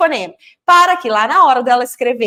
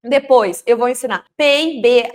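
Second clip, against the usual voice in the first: usual speaking voice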